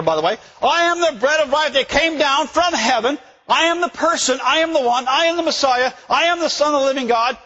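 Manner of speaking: high, impassioned shouted declaration; Jesus crying out. Acted. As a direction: raise the voice and hammer each claim, exasperated. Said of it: "you almost hear his voice go up".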